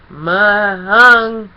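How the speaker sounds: loud garbled cry without a tongue; tongueless shout. A narrator's reading. The pony cries out after Discord steals his tongue, garbled and loud. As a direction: yell with no tongue to shape it.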